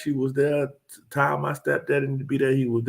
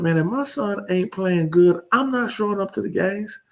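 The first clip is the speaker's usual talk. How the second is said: higher, quoting his own blunt post; quoting himself; 6 semitones higher than usual. Retold or imitated. To his followers, quoting his blunt post in a higher voice. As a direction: repeat his post in a raised voice. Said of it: "I said".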